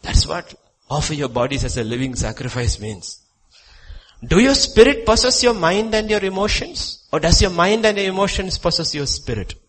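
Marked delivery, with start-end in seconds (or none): 4.2-9.6 loud preaching. Real, not acted. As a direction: raise it high and loud. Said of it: "repeated whispered".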